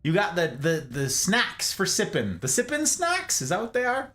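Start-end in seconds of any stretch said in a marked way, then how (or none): 2.4-4.1 high amused question; amused surprise. Real, not acted. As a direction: tease the new term.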